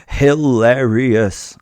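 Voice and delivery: loud, very drawn-out exclamation; exaggerated amusement. Narrator recalling a film scene to his victims, amused. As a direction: savour the word loudly, syllable by syllable. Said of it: "I laughed".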